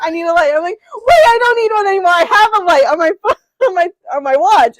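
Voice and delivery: laughing high and loud through the words. Real, not acted. Retelling her own panic with the light, laughing. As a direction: giggle out the memory.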